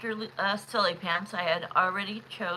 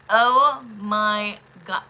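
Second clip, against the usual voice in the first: drawn-out, loud Oh my God; stunned exclamation; 8 dB louder, much slower than usual. Real, not acted. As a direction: let it land slowly, floored. Shocked at reading result.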